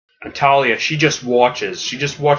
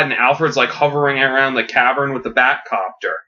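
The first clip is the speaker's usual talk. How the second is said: plain reading voice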